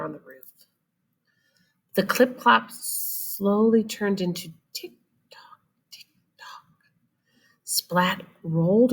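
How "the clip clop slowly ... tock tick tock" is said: unvoiced whispered tick tock. A narrator's reading. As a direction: whisper the clock sounds. Whispered sound effect narration.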